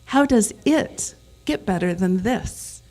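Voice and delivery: "it" punched, with a pause after it; stress on it. Shown as a demonstration. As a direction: hit "it" and break before the rest. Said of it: "where you emphasize each word in a sentence".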